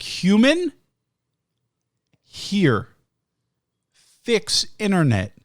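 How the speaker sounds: word by word with long pauses; slow, simplified speech. Retold or imitated. Caller to agent who isn't understanding, slow simplified speech. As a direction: spell it out slowly, one word at a time.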